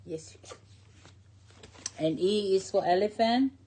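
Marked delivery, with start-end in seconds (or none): 0.1-0.6 quick hushed yes so the reading goes on; whisper. Real, not acted. Grandmother whispers permission to the child asking for orange.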